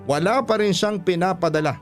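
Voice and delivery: usual speaking voice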